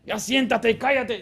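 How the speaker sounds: acted exasperated parent ordering sharply; harsh parental order. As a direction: scold a child into sitting down. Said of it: "nunca debemos usar esas palabras".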